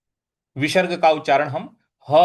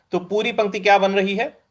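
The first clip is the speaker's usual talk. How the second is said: ordinary talk, nothing marked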